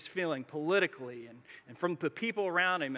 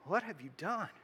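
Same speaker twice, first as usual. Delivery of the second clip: softer, grave question from above; God's quiet rebuke; 5 dB quieter than usual. Retold or imitated. God confronting Adam and Eve in the garden, soft and grave. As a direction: ask it softly but heavily. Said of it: "he says".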